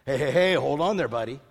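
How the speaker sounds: a hammed-up pal stopping a friend; mock casual rebuke. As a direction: playfully brush Thomas off. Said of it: "Did he say that? No".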